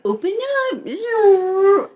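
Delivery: put-on high, drawn-out sing-song, as to a baby; airplane feeding voice. Friend imitates the date spoon-feeding a grown woman, high sing-song baby talk. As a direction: stretch it high like feeding a toddler. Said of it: "he would've been like".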